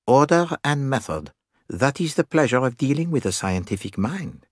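pleased, precise Poirot; approving Poirot. Acted.

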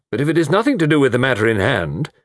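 stern colonel's objection; sharp. A narrator's reading. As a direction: put the visitor off sharply. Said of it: "said Colonel Melrose sharply".

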